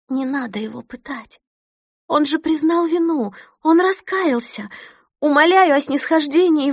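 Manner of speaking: high, desperate tearful plea; pleading. Acted. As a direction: beg for mercy, voice rising. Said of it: "жалобно застонала". (жалобная мольба)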